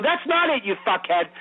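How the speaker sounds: high, sharp angry yell; angry retort. Retold or imitated. Beaten actor yelling back at the director, angry. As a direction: snap back at him furious. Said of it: "I'm pissed".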